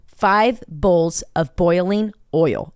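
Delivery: "Five bowls of boiling oil" at half speed, each word placed; slowly, carefully. Shown as a demonstration. Slow careful tongue-twister for the friend. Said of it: "See, I have to say it like that".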